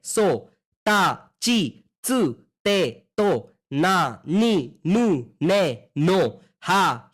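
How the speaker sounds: slow, even recital of the kana sounds, each clearly spaced; slow syllable drill. Shown as a demonstration. Teacher reading the kana row to students, slow and clear. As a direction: call out each syllable for the class to repeat.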